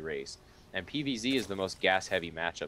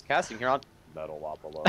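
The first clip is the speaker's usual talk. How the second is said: robot voice; 4 semitones lower than usual. Shown as a demonstration. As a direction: lower robot voice, a little stiff. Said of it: "I should say that in, like, a robot voice".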